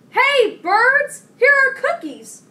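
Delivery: high, very loud calling voice; loud shout. A narrator's reading. Frog calling the birds to the cookies, shouting loudly. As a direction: call out far across the yard. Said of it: "He shouted in a loud voice".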